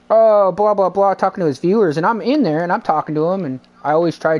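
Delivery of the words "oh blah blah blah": loud, higher mimicked blah-blah; mock chatter. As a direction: imitate someone chattering away loudly. Retells streamer talking to his viewers.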